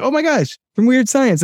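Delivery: spotting a familiar face on screen; excited recognition. Retold or imitated. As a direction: squeal of sudden recognition. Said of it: "I was like".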